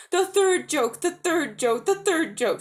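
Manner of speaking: high, loud, excited chanting; excited jackdaw. Acted. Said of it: "said the jackdaw in great excitement".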